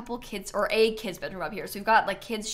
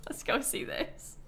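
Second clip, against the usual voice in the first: softer, breathy nervous whisper; hushed; 4 semitones higher, 8 dB quieter than usual. Real, not acted. Entering the spooky build, nervous whisper. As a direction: creep in nervously. Nervous about creepy house.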